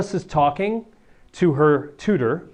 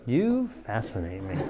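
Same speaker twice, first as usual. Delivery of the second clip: dismissive; 5 semitones lower, 9 dB quieter than usual. Shown as a demonstration. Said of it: "not like".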